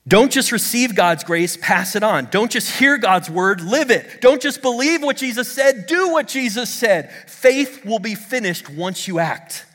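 high, driving exhortation; fervent preacher building in pitch; impassioned preaching. Real, not acted. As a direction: urge the congregation phrase by phrase. Preaching at full force.

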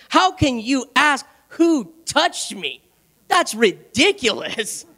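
slow, drawn-out disbelief; acted incredulity. Disciples questioning Jesus in the pressing crowd, mock incredulous. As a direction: spell it out slowly, as baffled disciples.

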